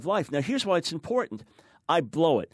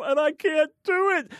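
despairing plea; 12 semitones higher, 3 dB louder than usual. Retold or imitated. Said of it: "the person who says".

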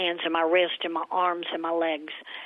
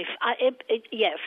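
normal talking voice

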